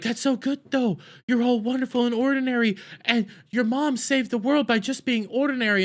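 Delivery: high, sugary mock praise; mock gushing. Show's writers praising the ordinary mom, mock gushing. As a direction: gush in a high mocking voice.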